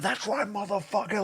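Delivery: high, mocking taunt. Drill taunting Kenny at ringside, high jeer. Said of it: "Drill is in Kenny's face, like".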